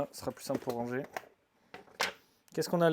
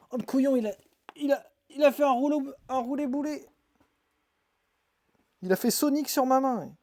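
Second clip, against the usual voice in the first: excited; 8 semitones higher, 5 dB louder, faster than usual. Real, not acted. L'isopode tombe et roule.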